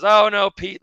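referee's "Oh, no, Pete" thrown out high and loud; mock referee scolding. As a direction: play the ref calling him out. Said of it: "the ref says".

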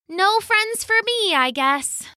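sad sigh. Acted. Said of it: "she said".

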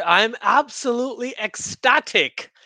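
drawn-out, mock-ecstatic sarcasm; very sarcastic. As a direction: feign delight, slowly and with heavy irony. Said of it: "I'm being very sarcastic".

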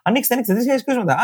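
high, sarcastic announcer tone; high mock announcement; mock official. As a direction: cheerfully parody the authorities. Mocking official reopening everything.

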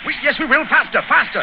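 high, shouted encouragement mid-run; urgent shout. Acted. Racing the train.

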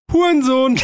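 high squeaky yell; prank-calling teen. Pretend. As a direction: shriek it like a bratty kid. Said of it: "dreizehnjähriger Olli ruft so an".

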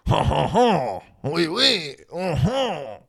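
high, drawn-out mock French oui, oui; mock French accent. Joking about how French sounds in his head, mock French accent. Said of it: "French in my mind".

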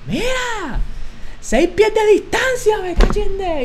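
high, slow mocking yell; imitating a yelling janitor. Janitor yelling at students across campus, mock shout. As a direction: ham up the angry janitor barking orders. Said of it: "gritado frente a toda la universidad".

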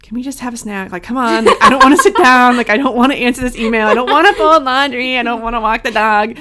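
put-on young inner self throwing a small tantrum; little kid voice. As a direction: pile up protests like a whiny kid. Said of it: "that little version of [name]'s like".

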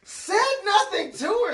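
high, drawn-out repeat in disbelief; incredulous echo. Real, not acted. Listener repeats storyteller's admission back to him, incredulous.

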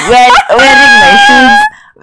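squealing through a laugh; words burst out laughing, high. Real, not acted. Laughing mid-sentence, very high.

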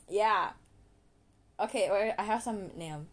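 ordinary talk, nothing marked

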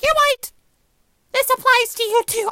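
high mocking friend voice; mocking Angela. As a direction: squeak it out like a know-it-all friend. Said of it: "she's like".

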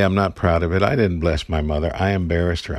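normal talking voice

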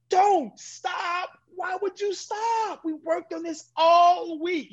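high, slow, exasperated shout; frustrated shout. Retold or imitated. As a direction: spell out each word of the anger. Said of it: "what you would read on my face".

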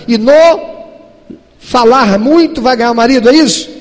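loud, high exhortation; full-force preaching. Acted. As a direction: press the point hard on the congregation.

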